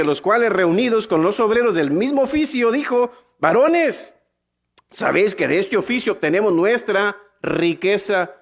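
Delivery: lifted preacher's scripture voice; scripture reading. Acted. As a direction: read the verse aloud to the crowd. Reads Bible verse aloud.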